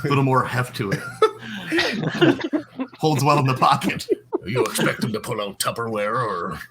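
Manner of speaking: words broken by giggles; talking through laughter; laughing. Real, not acted. Player giggling through pocket joke.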